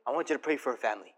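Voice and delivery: softly falling inner voice; Holy Spirit's voice. Retold or imitated. The Holy Spirit nudging Danny again, soft and dropping low. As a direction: let it drop low and quiet, a nudge from within. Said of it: "But I felt it again".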